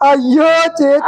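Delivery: high, drawn-out cry of dismay; distressed wail. Acted. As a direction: wail helplessly to the attendant. Man loses control of his bladder.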